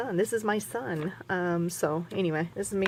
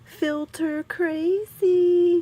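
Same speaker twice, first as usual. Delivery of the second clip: playful drawn out; 10 semitones higher, 8 dB louder, much slower than usual. Real, not acted. Joking about photo filters.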